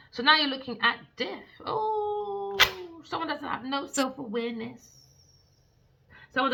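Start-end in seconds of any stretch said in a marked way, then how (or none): 1.7-2.6 high dramatic wail; theatrical lament; mock cry. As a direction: pretend sorrowful oh. Reacting to death card.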